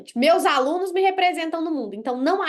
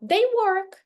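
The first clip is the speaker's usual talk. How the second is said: "They work" said higher and louder, as if showing working batteries; acted example line; 6 semitones higher, 4 dB louder than usual. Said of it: "tô com duas pilhas na mão e falo".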